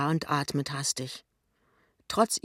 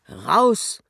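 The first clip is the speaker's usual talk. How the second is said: loud, higher one-word dismissal; curt order; 5 semitones higher, 9 dB louder than usual. A narrator's reading. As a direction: order the intruder out sharply. Pinneberg orders intruder out.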